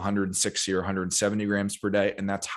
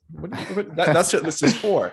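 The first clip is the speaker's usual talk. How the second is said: high-pitched, stammering disbelief; incredulous rebuttal; 9 semitones higher, 4 dB louder than usual. Acted. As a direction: cut in on the excuse, pitched high.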